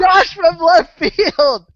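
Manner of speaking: drawn out slow, high and loud cry of Josh; excited exclamation. Real, not acted. Friend gleefully exclaims the surprise culprit's name, loud and slow. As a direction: savour the name like a big reveal.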